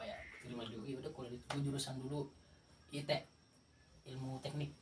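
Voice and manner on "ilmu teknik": ordinary delivery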